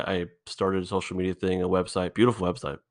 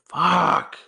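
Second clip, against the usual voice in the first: sharp, high, louder burst of frustration; exasperated curse; 12 semitones higher, 5 dB louder than usual. Real, not acted. A podcaster admitting he's quitting his show, real frustrated curse. Frustrated outburst mid confession.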